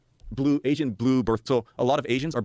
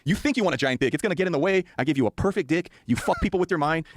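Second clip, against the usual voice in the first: higher-pitched alien lecture, played for laughs; emphatic comic retelling of an alien's rant; lightly voiced mouthy alien; 6 semitones higher than usual. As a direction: lightly imitate an alien setting someone straight. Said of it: "And he goes".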